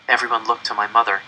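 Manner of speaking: plain reading voice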